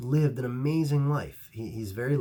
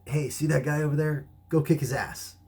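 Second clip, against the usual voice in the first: raised, louder order imitating the grandmaster; mock commanding tone, higher and louder; retold command; 6 semitones higher, 5 dB louder than usual. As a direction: call out an order to a student.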